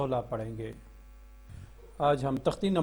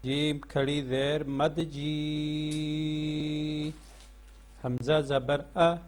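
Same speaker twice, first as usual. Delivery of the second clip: slow sing-song spelling chant, drawing out each joined word; measured classroom recitation of the Noorani Qaida; much slower than usual. Shown as a demonstration. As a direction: chant each letter and its vowel for pupils to repeat. Teacher chants spelling drill.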